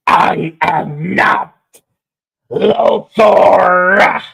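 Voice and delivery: anguished villain wail; villain cry. Retold or imitated. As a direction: howl it like a defeated villain. Quoting Zed's villain cry.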